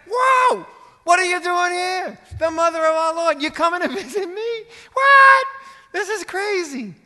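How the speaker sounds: very high, loud shriek of amazement; excited shouting. Acted. Preacher acting Elizabeth greeting Mary at the door, excited shouting. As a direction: shout it in astonished, overjoyed disbelief. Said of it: "She's shouting. She's excited".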